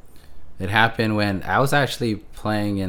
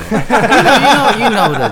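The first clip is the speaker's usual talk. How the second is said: high laughing protest; 13 dB louder, slower than usual. Real, not acted. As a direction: laugh it off, higher. Laughing off N Sync tease.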